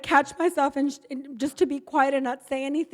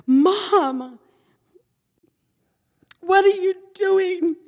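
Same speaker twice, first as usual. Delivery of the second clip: higher, louder and slow, upset daughter; a hint of the daughter, louder and higher; daughter's upset voice; 7 semitones higher, 7 dB louder, much slower than usual. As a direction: voice the daughter's shocked demand. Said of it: "she says".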